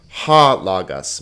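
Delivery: "Ha logos" slowly, with an audible H starting "Ha"; clear H sound. Shown as a demonstration. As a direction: get the H into "Ha" of "Ha logos", unhurried. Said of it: "Make sure you get the H sound in there".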